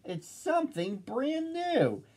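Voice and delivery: thrilled storybook crowd cry; amazed shouting. A narrator's reading. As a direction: exclaim it, building to the reveal. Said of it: "they shouted".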